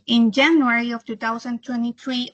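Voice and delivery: ordinary delivery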